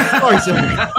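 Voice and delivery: mock soldier apology, laughing; laughing through quote. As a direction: giggle out the apology. Quoting cartoon soldier while laughing.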